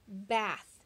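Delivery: normal talking voice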